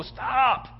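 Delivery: high, drawn-out cry of alarm; acted high-pitched urgent command; urgent halting cry. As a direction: call a sudden halt from afar, pitched high. Angel halts the knife, first take.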